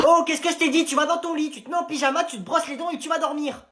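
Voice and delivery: high, stern mom voice; scolding mother. Acted. Mother orders her son to bed, high and stern.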